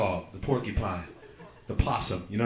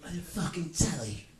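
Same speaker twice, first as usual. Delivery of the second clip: slower hammed Cockney line; mock British; 6 semitones higher than usual. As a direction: drawl it like a geezer. Mocking British movie dialogue.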